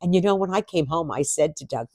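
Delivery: ordinary talk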